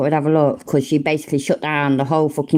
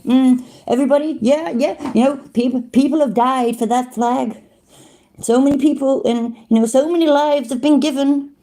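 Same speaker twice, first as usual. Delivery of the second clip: higher-pitched mock American accent; 7 semitones higher than usual. Mocking the cameraman's flag speech, put-on American accent. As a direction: put on your best American, mimicking an earnest patriot. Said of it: "That's my best American accent".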